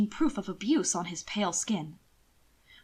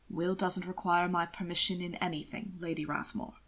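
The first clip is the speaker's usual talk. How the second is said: lower, smooth aristocrat voice, teasing; a sardonic gentleman savouring his jab; low sly nobleman; suave lord, dry; 3 semitones lower, 6 dB quieter than usual. Acted. Hannibal's strained politeness.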